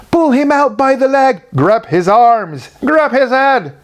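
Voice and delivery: high loud urgent shouts; shouting. A narrator's reading. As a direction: shout frantic rescue orders. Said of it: "they shouted".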